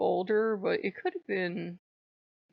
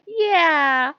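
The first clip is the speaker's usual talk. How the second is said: loud drawn-out yeah; 10 semitones higher, 9 dB louder than usual. Retold or imitated. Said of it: "I go".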